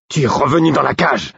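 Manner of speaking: high-pitched, urgent exclamation; Œil de Nuit, crying out. A narrator's reading. Wolf cries out to trapped companion, alarmed cry. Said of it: "s'écria Œil de Nuit".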